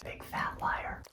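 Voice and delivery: hushed, breathy aside calling them liars; conspiratorial whisper; stage whisper. Acted. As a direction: lean in and whisper it like a secret insult. Repeated whispered insult.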